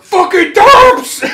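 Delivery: high, slow, loud outburst; angry yell. Acted. Kyle raging at the Derpy Boys, a high furious yell. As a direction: spit the insult out in a rage. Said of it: "Kyle's like".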